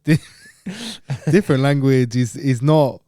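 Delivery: hammed-up, drawn-out Thai accent; slow sing-song fake Thai accent; mock Thai accent. Shown as a demonstration. Asked for his Thailand voice, mock Thai accent. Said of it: "when you were going to Thailand".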